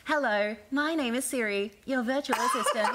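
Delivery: put-on bright virtual assistant, Australian accent; Australian Siri voice. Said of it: "the Australian one is like".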